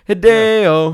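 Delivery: loud, high announcer call; ring announcer. Pretend. Announcing wrestler name.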